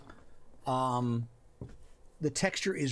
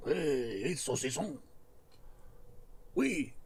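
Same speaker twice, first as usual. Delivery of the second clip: hammed-up, really bad French accent; playful mock-French voice; 4 dB quieter than usual. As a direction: badly fake a French accent. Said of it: "Really bad French accent".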